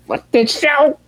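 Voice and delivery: very high exasperated cry; overwhelmed squeal. Retold or imitated. Viewer reacts to the heavy ending, overwhelmed squeal. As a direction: squeak it out in mock despair.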